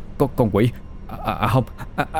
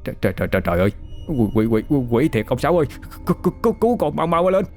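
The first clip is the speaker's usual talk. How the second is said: panicked stutter, climbing higher and faster; terrified stammer; 4 semitones higher, much faster than usual. Acted. Boy stammers in terror at the skeleton demon. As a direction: babble in terror, repeating words. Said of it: "Nó lắp bắp".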